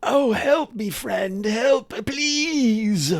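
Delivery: high-pitched, drawn-out pitiful moaning; Urks pleading. Acted. Game master as Urks begging for help, plaintive wail. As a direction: plead in a high wailing character voice. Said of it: "but Urks is like".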